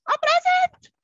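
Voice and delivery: "I'm present." jumps to a very high squeal; squeaky high present. Shown as a demonstration. Teacher modelling the roll answer for jumping kids, very high squeal. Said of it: "You have to jump up and down and say".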